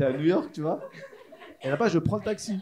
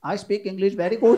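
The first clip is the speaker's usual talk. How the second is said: slow, slightly raised Indian-English impression; Indian-accented English; 6 dB louder than usual. Job candidate proving he is bilingual, exaggerated Indian accent. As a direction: answer proudly in a put-on Indian accent. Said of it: "Je suis parti en Inde".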